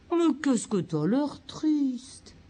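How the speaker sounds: lent et traînant, plein de tristesse; étirez chaque mot avec tristesse; prosodie triste. Shown as a demonstration. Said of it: "Ma prosodie est une prosodie de tristesse". (voix triste et ralentie)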